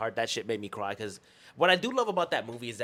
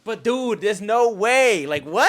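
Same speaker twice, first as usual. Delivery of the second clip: loud, raised-pitch disbelief; incredulous protest; 6 semitones higher, 8 dB louder, much slower than usual. Real, not acted. Fan arguing with friend over a missing accent, incredulous. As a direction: protest it loudly, dragging out the middle.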